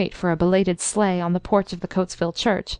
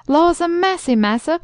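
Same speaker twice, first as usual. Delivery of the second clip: much higher startled cry; cried out; 8 semitones higher than usual. A narrator's reading. Old servant realizing the noise is his turkey, a high cry. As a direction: exclaim in sudden dismay. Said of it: "cried the old darky".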